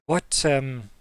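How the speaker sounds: a curious gnome itching to tinker; excited gnome. Acted. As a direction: lean in keen to know. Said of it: "quite excited".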